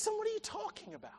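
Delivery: high, soft, quick plea; pleading. Acted. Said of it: "He's pleading with him".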